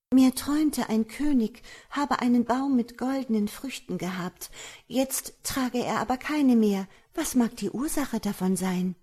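wife, recounting a dream. A narrator's reading. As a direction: coax an answer with a dream tale. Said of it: "Sie sprach". (hohe Frauenstimme, einen Traum erzählend)

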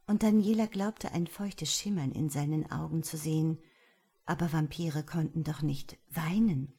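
ordinary delivery

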